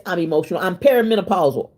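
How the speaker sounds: ordinary talk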